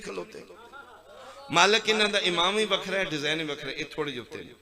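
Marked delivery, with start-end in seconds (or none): 1.5-3.8 high and loud, pressing the point; loud report. Acted. Angel reports loudly to Allah about this row. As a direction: declare it up to the Lord.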